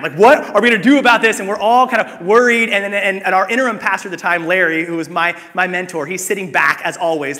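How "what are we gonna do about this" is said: louder, higher panicked cry; mock panic, voice shooting up; freaking out. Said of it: "I just start freaking out".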